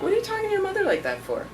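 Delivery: high, fast, outraged scold; indignant scolding. Retold or imitated. Aunt scolding her niece over the phone, high, fast and indignant.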